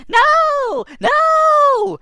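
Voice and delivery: squeaky spark voice, high and loud; tiny creature snapping back; very high little no. Acted. Ball of light teasing the boy, very high.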